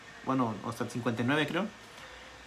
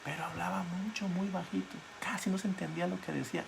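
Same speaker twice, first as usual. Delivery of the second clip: much softer, almost inaudible, to show how she spoke; very softly; 6 semitones higher, 6 dB quieter than usual. Shown as a demonstration. Preacher showing how faintly the wife spoke, very soft. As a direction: drop to a near murmur. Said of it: "hablaba bien bajito".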